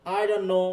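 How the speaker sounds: "I don't know" said as "I don-no"; dropped t. Shown as a demonstration. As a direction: run "don't know" together without the t. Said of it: "টিটা বাদ পড়ে যাচ্ছে". ("don't know"-তে t বাদ দিয়ে বলা)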